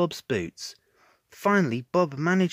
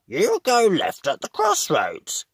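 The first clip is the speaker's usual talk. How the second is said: Spud's voice; 6 semitones higher, 5 dB louder than usual. A narrator's reading. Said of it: "said Spud".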